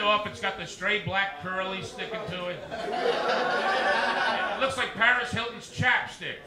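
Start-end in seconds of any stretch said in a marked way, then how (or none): 2.7-6.4 drawn-out punchline. Acted.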